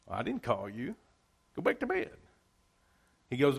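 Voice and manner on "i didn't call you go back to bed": put-on Eli brushing off the boy, sending him back to bed; sleepy old Eli. Old Eli to young Samuel at night, a lightly voiced weary dismissal.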